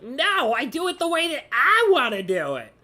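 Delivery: loud, high, stubborn mock protest; mock-stubborn refusal, pushed high; defiant staircase. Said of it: "It doesn't even care".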